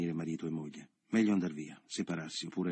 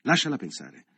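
louder, insistent rebuke; angry; 7 dB louder than usual. A narrator's reading. Leo telling Michele off in front of Carla, angrily. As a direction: put the brother in his place.

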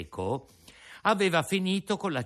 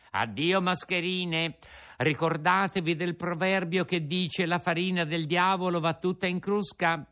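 mocking Pinocchio; 8 semitones higher than usual. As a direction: wave them off with scorn. Pinocchio taunts.

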